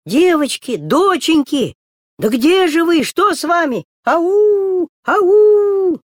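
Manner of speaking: put-on high, loud, anxious shout for the girls; worried calling. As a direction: call out, growing worried. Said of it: "Мама кричит".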